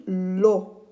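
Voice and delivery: "l'eau" stretched slowly as one syllable; elided reading. Shown as a demonstration. Teacher repeating the reading for pupils, long blended "l'eau". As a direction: hold "l'eau" long, l straight into o. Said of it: "pour lire l'eau".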